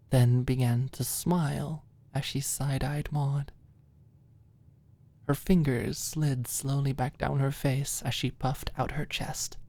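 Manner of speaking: ordinary delivery, nothing marked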